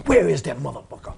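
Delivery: mock furious outburst. Orndorff flipping out over the injured ankle, mock furious. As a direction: snap into a rage, hammed-up. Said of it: "he flipped the switch".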